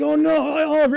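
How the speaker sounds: high, loud cry of dismay at his son; retold yell. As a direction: call out in alarm across the field. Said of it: "I'm, like, yelling".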